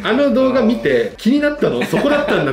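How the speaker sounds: quoting his own surprised reaction, laughing; a baffled "that's what you noticed?"; amused surprise. Retold or imitated. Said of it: "っていうのがすごい面白くて".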